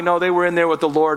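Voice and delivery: ordinary talk, nothing marked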